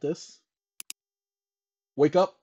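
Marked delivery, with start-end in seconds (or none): 2.0-2.3 sharp loud wake-up call. Real, not acted. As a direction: shout it high to rouse them. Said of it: "calling out to viewers".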